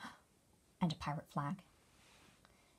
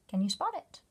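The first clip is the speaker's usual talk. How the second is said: ordinary delivery, nothing marked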